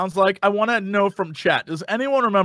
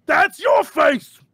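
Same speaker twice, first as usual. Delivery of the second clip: slow shouted ogre line; dim giant; 7 semitones higher, much slower than usual. Acted. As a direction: say it like a proud dumb ogre. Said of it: "I'm like".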